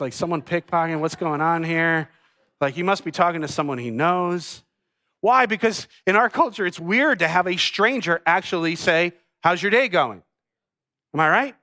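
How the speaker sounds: ordinary talk, nothing marked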